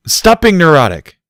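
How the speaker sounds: furious barked order; angry bark. A narrator's reading. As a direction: snap him out of it hard. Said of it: "Barry barked angrily".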